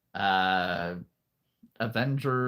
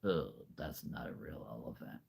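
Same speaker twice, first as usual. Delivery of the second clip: low, soft mock voice of a skeptical kid; imitating nieces; 11 semitones lower, 15 dB quieter than usual. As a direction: play a doubtful niece, muttered under the breath. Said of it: "my nieces would be like".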